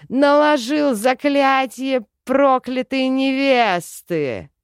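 high, loud, slow shout; outraged roar. A narrator's reading. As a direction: shout the accusation furiously, drawing it out. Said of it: "рявкнул Тей". (гневный протяжный крик)

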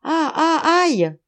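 high, loud wail of fear; terrified cry. A narrator's reading. Schultz as the dragon draws near, terrified cry. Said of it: "il cria tant grande était sa peur".

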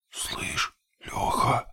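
hushed, spooked whisper; very soft, breath-only call; frightened whisper. A narrator's reading. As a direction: whisper his name in alarm. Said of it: "испуганным шёпотом окликнул меня".

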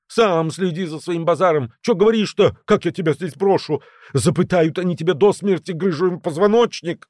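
high, heated retort; snapping back. A narrator's reading. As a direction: snap back indignantly, pitched well up. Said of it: "огрызнулся Грыжа".

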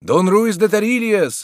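high-pitched cry of recognition; crowd shout. A narrator's reading. Spectators stepping back as the father appears, crying out. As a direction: call the name out high, as a crowd would. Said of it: "закричали, отступая зрители".

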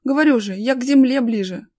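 loud, proud boast; acted self-satisfaction; triumphant. A narrator's reading. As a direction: claim the credit emphatically. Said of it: "торжествующе заявил дядя Вова".

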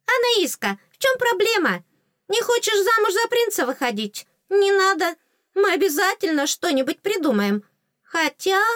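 very high little fairy voice, reassuring; eager fairy. Acted. Fairy offers heroine a way out, high and eager. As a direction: pipe up brightly and high, solving the problem.

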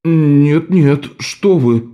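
frightened. A narrator's reading. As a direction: back off in alarm. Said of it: "испуганно отпрянул Калла".

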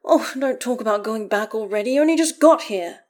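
loud protest climbing in pitch. A narrator's reading. Astarion upset at talk of going back, protesting. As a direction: object with rising insistence. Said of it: "Astarion protests".

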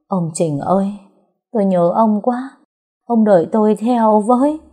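lost in grief, reaching for him; dazed longing. A narrator's reading. Widow calling out to her dead husband, dazed and longing. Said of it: "xong lại thẫn thờ".

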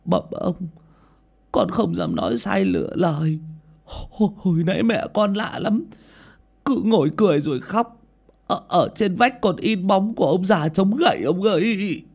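frightened stammer. A narrator's reading. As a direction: blurt it out still scared. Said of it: "lắp bắp kể lại đầu đuôi".

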